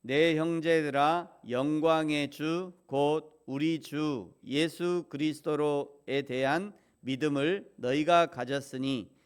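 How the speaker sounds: loud, slow, measured scripture reading; slow unison reading. Real, not acted. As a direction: lead the congregation through the verse slowly and loudly. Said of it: "우리 같이 한 목소리로 천천히 읽겠습니다".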